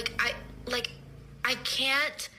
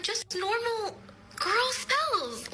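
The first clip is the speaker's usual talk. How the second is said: much higher, slow sing-song innocence; mock innocent; 11 semitones higher, much slower than usual. Assuring her scared date, high slow mock-innocent voice. Innocent witchy reply to boyfriend.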